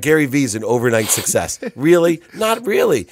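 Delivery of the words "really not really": much higher and louder, scoffing really; mock disbelief. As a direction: blast the idea with loud incredulity. Mocking the overnight-success myth.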